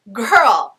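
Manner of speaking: put-on loud, flat exasperated girl. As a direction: drop it like a done retort. [name] dismisses Imogen's question.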